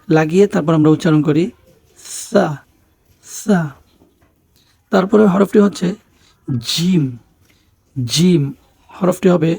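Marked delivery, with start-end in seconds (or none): 2.3-3.6 সা as a light, lisped "tha"; tongue tip at upper front teeth for সা; very thin সা. Shown as a demonstration. Teacher models the Arabic letter, thin "th" in সা. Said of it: "দাঁতের আগার সাথে জিহ্বার আগা". 6.7-8.4 জিম with a hard "j" onset; firm জিম. Shown as a demonstration. Teacher models the Arabic letter, firm "j" in জিম. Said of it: "একটু শক্ত".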